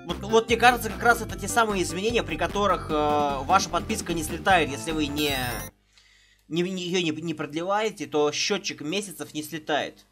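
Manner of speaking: ordinary talk, nothing marked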